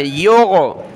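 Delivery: योगो with a throaty gh in place of g; योगो, middle consonant as Arabic ghain; ghain sound. Shown as a demonstration. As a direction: bring the g of योगो from the back of the throat. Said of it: "गैन निकाले".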